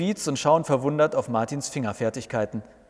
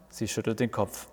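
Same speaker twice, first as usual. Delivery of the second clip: plain reading voice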